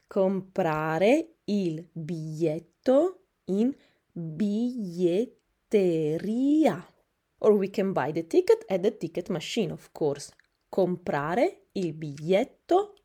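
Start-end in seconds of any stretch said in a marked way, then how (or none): 0.1-6.9 "biglietteria" drawn out syllable by syllable; very slow pronunciation. Shown as a demonstration. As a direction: break "biglietteria" slowly into its syllables. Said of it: "teacher syllable-by-syllable repeat". ("biglietteria" said extremely slowly)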